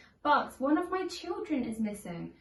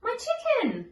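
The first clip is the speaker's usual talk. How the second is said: much higher delighted exclamation; surprised cry; 11 semitones higher than usual. Acted. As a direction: cry out at finding it. Discovering the lost things.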